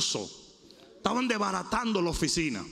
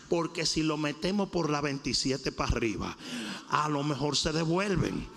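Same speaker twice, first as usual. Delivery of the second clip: low, relaxed, chuckling voice of God; preacher voicing God as a laid-back guide; God speaking casually; 7 semitones lower than usual. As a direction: play God giving friendly route advice, lower. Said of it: "Él dijo".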